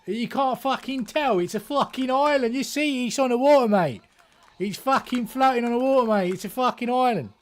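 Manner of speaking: high, loud mock British bloke; a British fella saying island in his thick accent; heavy British accent. British fella insisting it is an island, loud thick accent. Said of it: "British fella with a heavy accent".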